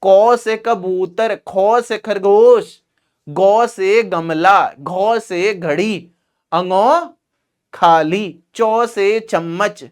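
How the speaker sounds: slow, sing-song primary teacher drilling 'को से कबूतर'; primary school ma'am. Retold or imitated. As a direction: stretch each letter to 'o' like a primary ma'am. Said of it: "primary में ma'am थी तुम्हारी?".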